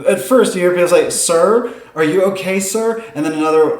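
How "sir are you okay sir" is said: lightly voiced worried passerby, higher pitch; concerned passerby. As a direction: check gently on a raving stranger.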